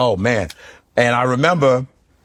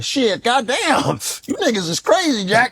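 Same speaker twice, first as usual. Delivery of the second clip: Richard Pryor impression; 7 semitones higher than usual. Comedian imitating Richard Pryor talking to Shannon Sharpe, much higher. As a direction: squeal and cuss like Pryor. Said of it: "if you do Pryor".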